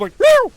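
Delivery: a cat splatting on the windshield; cat meow imitation. Cat hitting the windshield, high squeaky meow. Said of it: "see the cat just, like, hit the windshield".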